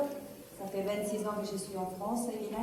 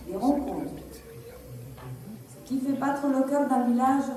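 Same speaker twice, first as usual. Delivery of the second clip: a touch louder, measured public address; formal speech read; 4 semitones higher, 6 dB louder than usual. Acted. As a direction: read it like a campaign speech to the village. Reads a prepared campaign speech. (discours de campagne lu, un peu plus fort et posé)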